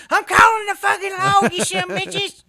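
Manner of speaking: high screamed threat to call the law; furious shout. Retold or imitated. Southside neighbor screaming at fighters, hammed-up shout. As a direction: yell it at the top of your lungs. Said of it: "he'd be like".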